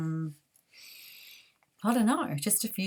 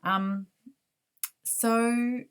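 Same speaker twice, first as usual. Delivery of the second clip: ordinary talk, nothing marked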